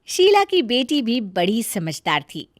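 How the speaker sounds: usual speaking voice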